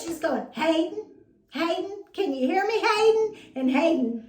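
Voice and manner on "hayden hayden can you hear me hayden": high-pitched hailing, name repeated; imitated calling out. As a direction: mimic someone checking if they're heard. Retold calling out to Hayden.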